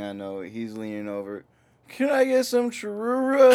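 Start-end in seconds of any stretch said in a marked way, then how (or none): 1.9-3.6 very high, loud mock order; re-enacted drive-thru yell. Friend leaning over to order at drive-thru, high yell. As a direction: holler the silly order way up high.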